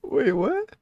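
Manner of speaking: high disbelief. Real, not acted.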